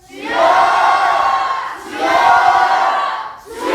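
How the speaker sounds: loud prolonged prayer cry; long shouted prayer. Real, not acted. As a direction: cry out as long as you can. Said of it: "가장 길게".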